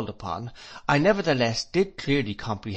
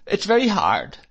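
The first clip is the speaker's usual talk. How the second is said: higher and louder, a hurt protest; plaintive complaint; 9 semitones higher, 5 dB louder than usual. A narrator's reading. Mother complains to her husband, higher and louder. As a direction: push the complaint up, wounded. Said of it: "said my mother".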